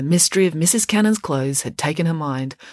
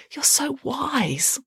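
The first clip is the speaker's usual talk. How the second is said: slow, higher, fawning "You're so wise"; lightly voiced fawning girl; 10 semitones higher, much slower than usual. A narrator's reading. Melanie buttering up her teacher, slow and fawning. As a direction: stretch it out like a sweet flatterer.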